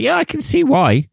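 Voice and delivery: pitched far up, dry sarcastic quip; mock the bullied kid with a high sneer; sarcastic agreement. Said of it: "and I'm like".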